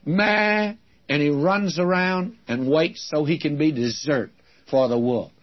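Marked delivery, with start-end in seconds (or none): 0.1-0.7 high mock baa of a lost sheep; preacher imitating a lost sheep's bleat. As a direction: bleat it like a helpless little sheep. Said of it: "All he does is go".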